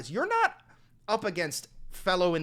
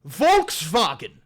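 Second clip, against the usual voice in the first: disbelief; 6 dB louder, slower than usual. Real, not acted. Incredulous at Volkswagen claim.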